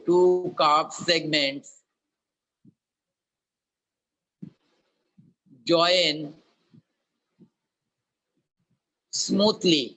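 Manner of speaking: slow, clear dictation with long pauses; dictating. Acted. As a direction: dictate each word slowly for note-taking. Teacher dictates the problem statement.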